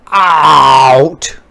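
loud croaked "Out!"; croaking frog. A narrator's reading. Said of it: "croaked the frog".

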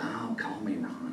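slow soft whisper of a friendly president; hushed Reagan, folksy. Retold or imitated. Reagan tells Tip to call him Ron, slow whisper. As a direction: breathe it slowly, easy and chummy.

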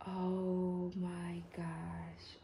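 very slow, drawn-out amazement; awestruck. Real, not acted. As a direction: stretch every word in awe. First look at the doll.

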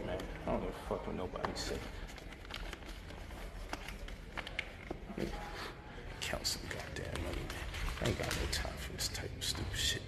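muttered under his breath, barely voiced; whispered grumbling. Real, not acted. Cursing to himself while counting money, whispering.

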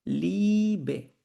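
লিবে with a long, drawn-out "ee"; German pronunciation demo. Shown as a demonstration. Teacher models German word to class, লিবে stretched slowly. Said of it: "উচ্চারণ করতে হবে".

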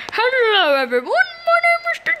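high, silly gentleman introduction; playful gentleman. Pretend. Player introducing a new gentleman character, hammed-up. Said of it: "I'm going to be the gentleman".

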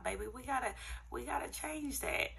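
high sassy sister-to-sister call. Real, not acted. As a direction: tell them straight, with attitude. Sassy aside to viewers.

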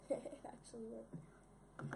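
slow whispered disbelief with a laugh; amazed whisper. Real, not acted. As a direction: breathe it out in disbelief.

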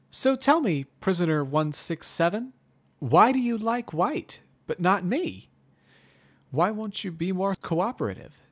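high, loud pony pressing for answers; questioning mare voice. Acted.

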